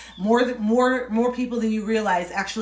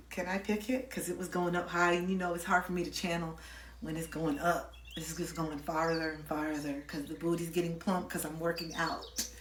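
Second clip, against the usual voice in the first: dropped to a near whisper, much softer; quiet embarrassed aside, fading into breath; hushed aside; 4 semitones lower, 11 dB quieter than usual. Real, not acted. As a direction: murmur it off to the side as if not meant for the mic. Aside while picking her wedgie.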